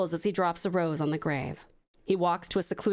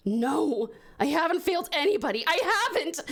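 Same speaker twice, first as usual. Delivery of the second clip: desperate denial; 9 semitones higher than usual. Acted. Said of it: "Anaconda says in desperation".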